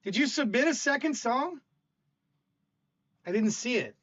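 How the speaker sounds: ordinary delivery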